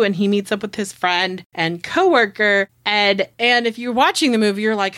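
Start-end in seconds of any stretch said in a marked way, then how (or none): none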